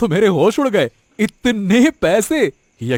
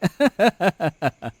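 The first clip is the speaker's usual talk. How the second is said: sly laugh. Acted. Accomplice laughs.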